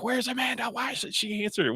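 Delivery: high-pitched imitation of fretting stage crew; mock-anxious calling for a missing actress; puzzled crew calling. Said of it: "she's got the little headset on her, and they're like".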